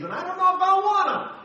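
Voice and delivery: high, loud squeaky whine. Retold or imitated. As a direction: squeal the excuse in a silly high voice. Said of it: "leaning on my own understanding".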